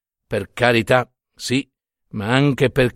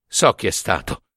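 ordinary delivery

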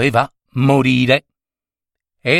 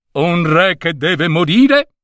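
put-on old wizard; 5 semitones higher than usual. Wizard insists to king.

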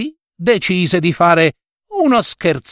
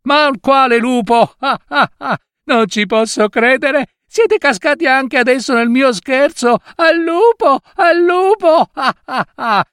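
mocking laughter; 11 semitones higher than usual. Boy mocks the tricked farmers again.

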